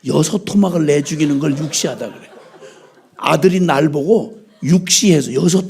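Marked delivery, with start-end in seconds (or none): none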